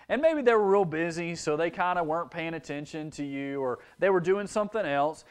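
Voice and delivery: ordinary delivery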